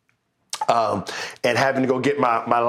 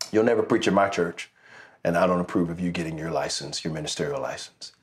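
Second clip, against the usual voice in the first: a low, quiet, cold voice sinking as it goes; cold rejection; 7 semitones lower, 4 dB quieter than usual. Retold or imitated. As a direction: imitate the man flatly shutting him out, growing softer. Retelling pastor rejecting him.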